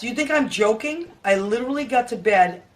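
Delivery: usual speaking voice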